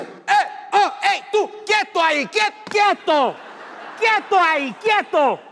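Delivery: high, loud, repeated commands; acted panicked warding off. Comedian stopping a man climbing on stage, acted panic. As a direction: ward him off in a hammed-up panic.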